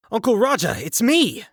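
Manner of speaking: high, urgent call out; yelled. Acted. Arthur yelling at the rushing uncle, high urgent shout. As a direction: shout it so he stops swinging. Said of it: "I yelled".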